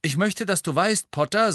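high and loud, projecting every word; loud carrying voice. A narrator's reading. Ernie speaks up to Harry, loud and carrying. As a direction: Ernie booming so the whole class hears. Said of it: "mit lauter, tragender Stimme".

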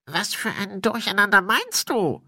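high put-on spirit voice; querying ghost. Spirit questions Windel.

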